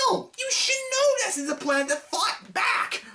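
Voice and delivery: real strained rant at its peak; exasperated rant. Real, not acted. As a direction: push the point in disbelief. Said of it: "ranting a bit".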